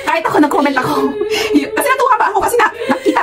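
high-pitched giggly telling; talking through real laughter; laughing. Real, not acted. Laughing while telling own comment.

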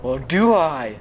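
loud, theatrical villain challenge; big dramatic mock menace. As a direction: swell into a hammy threat.

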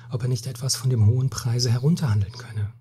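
usual speaking voice

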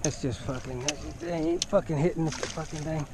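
ordinary talk, nothing marked

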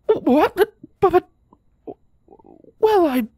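high, stammering and flustered; flustered stutter. Acted. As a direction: caught off guard, tripping over words. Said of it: "she stuttered, completely and utterly flummoxed".

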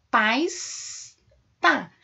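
"Paz, tá" as pasta cut into pas, ta; sílaba por sílaba. Shown as a demonstration. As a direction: hold the s of "Paz", pause, then punch "tá". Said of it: "basta separar em sílabas". ("Paz, tá", pasta separada em duas sílabas)

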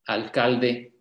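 ordinary delivery

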